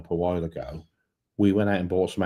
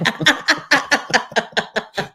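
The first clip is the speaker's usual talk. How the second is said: high burst of laughter; 4 dB louder than usual. Real, not acted.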